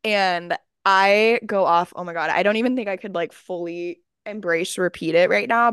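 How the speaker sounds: ordinary talk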